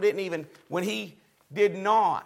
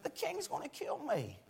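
much softer, almost breathed worried repeat; hushed fearful repeat; 5 semitones higher, 17 dB quieter than usual. Retold or imitated. Prince of eunuchs fears king.

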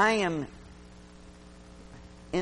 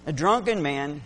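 usual speaking voice

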